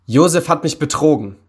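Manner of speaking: wrathful accusation; furious shout. A narrator's reading. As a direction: cry out at being betrayed. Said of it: "schrie er wütend".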